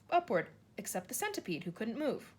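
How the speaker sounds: ordinary delivery, nothing marked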